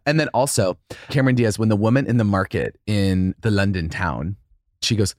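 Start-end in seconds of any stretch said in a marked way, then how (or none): none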